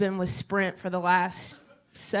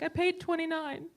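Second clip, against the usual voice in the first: mom voice; 8 semitones higher than usual. Retold or imitated.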